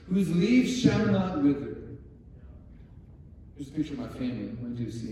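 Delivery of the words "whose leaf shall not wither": "leaves" and "not" hit loud, high and slow; emphatic repeat. Acted. Preacher repeating the key verse to the congregation, slow emphatic proclamation. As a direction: proclaim it slowly and louder, leaning on "leaves".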